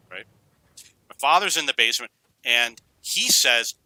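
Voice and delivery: ordinary delivery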